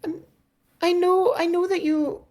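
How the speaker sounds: very high, faint, half-whispered plea; a frail girl begging without looking up; weak high voice pleading with the sortie. Acted. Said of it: "in a weak voice".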